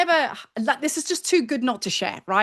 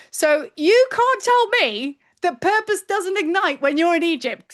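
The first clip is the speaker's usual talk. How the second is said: fired-up; 6 semitones higher, 4 dB louder than usual. Real, not acted. Emphatic climax of story.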